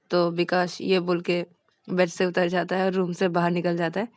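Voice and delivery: ordinary delivery, nothing marked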